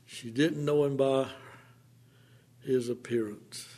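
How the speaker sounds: ordinary talk, nothing marked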